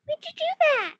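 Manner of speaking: high, loud fretting; fussing character voice. Acted. Mouse fussing over the captain's eye, high alarmed voice. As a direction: squeal with worried alarm.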